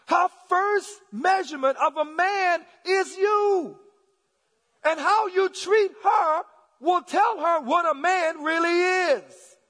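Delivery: high, loud, drawn-out exhortation; full-force preaching. Real, not acted. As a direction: drive it home to the fathers at full voice.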